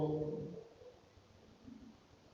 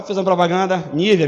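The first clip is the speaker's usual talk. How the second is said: ordinary talk, nothing marked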